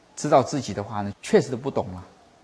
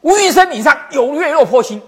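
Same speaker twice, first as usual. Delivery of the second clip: loud proclamation; 9 semitones higher, 8 dB louder than usual. Retold or imitated. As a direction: proclaim the verse high and loud. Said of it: "石霜楚元就大声讲".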